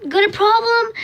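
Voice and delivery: sassy retort. Retold or imitated. Crying girl snaps back at people staring at her foot. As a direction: challenge the starers.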